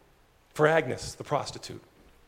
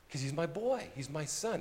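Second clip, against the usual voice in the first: much softer, hushed and loving; tender father; 2 semitones lower, 13 dB quieter than usual. Acted. Father claiming his returned son, softly tender. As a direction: nearly whisper your love for him.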